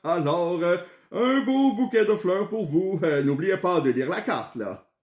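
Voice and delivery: put-on high-pitched old man voice; vieux monsieur Pomerleau. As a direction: play an old gentleman, pitched well above your own voice. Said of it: "monsieur Pomerleau de répondre". (voix aiguë de vieux monsieur)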